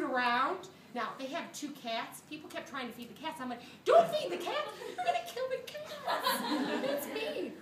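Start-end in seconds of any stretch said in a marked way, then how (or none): 3.8-7.5 much higher, laughing panicky squeal; frantic squeak. Retold or imitated. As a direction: squeal it like hidden alarm. Frantic aside about the cats.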